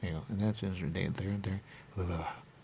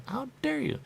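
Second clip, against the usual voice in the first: mock indignant high. Indignant quoted outburst.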